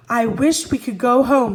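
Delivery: put-on a boy shouting the wish. A narrator's reading. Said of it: "he shouted".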